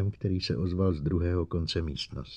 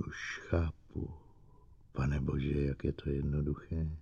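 hushed realization; 4 semitones lower, 11 dB quieter than usual. Acted. Susan realizing the robot lied to her, hushed whisper. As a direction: let the truth sink in under your breath.

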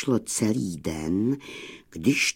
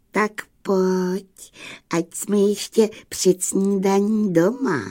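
lightly voiced ram, high pitch; ram's voice; 8 semitones higher than usual. A narrator's reading. Ram calling the surprised ewe home, light high character voice.

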